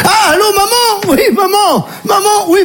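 harried son answering. Acted. Son takes call from his mother.

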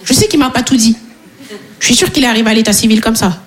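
ordinary delivery

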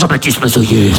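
deep voice-over name drop; trailer announcer. Acted. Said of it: "film de flic".

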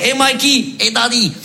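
father and son. Retold or imitated. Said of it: "Il fait".

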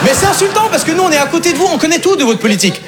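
protestation outrée, très haute; s'offusquer haut et fort pour rire; indignation jouée. Acted. S'indigne de l'ignorance du public. (faussement outré)

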